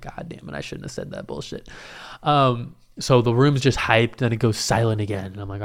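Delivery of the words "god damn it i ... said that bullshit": very low, hushed muttering; acted private regret, barely voiced; regretful self-talk. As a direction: curse yourself under your breath. Inner regret after the crowd cheers.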